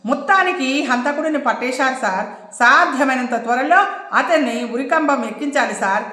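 ordinary delivery

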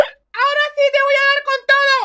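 high, forceful warning; furious threat. Acted. Mother about to hit the drunk harder, furious. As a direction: threaten while winding up for a blow.

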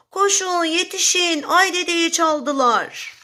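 a read-aloud hint of a crowd yelling; mild narrated shouting. A narrator's reading. Forest voices raising the alarm, mild mock shout. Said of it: "Birileri bağırıp kaçışmaya başladılar".

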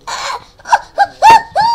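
shrill pretend screams; high scream.

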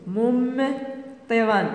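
"ممطرا" slowly, holding the first syllable; slow dictation. Shown as a demonstration. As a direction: split "ممطرا" after a long hummed "مم".

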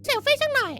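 normal talking voice

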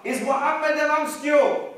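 high, loud taunting call; screamed out. Acted. Enemy leader calls up to the Prophet on the mountain, screaming out. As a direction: shout it out across a distance. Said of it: "He screamed out".

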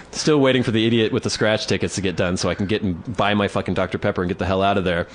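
normal talking voice

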